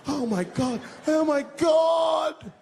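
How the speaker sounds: high, loud shrieking bystanders; screaming crowd. Retold or imitated. Park crowd screaming at the fight, shrieking. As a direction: scream it in panic.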